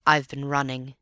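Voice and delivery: ordinary delivery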